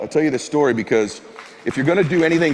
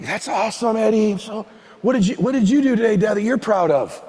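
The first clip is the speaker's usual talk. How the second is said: higher-pitched, lightly acted father-son exchange; eager chat; 9 semitones higher than usual. Son drawing his dad into talk, eager chat. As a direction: keep dad talking with keen interest.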